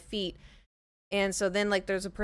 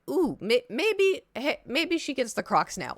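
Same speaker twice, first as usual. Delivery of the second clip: a lightly voiced God, pitch jumping up on maybe; hint of a teasing higher God voice; playful voice of God; 5 semitones higher, 3 dB louder, slower than usual. God nudging her toward the Crocs, lightly voiced and playful. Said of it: "it felt like God was like".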